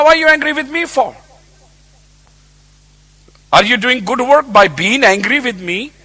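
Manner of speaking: high-pitched, insistent rhetorical questions; God questioning Jonah. Acted. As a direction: press the rebuke, question after question. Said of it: "God spoke to Jonah".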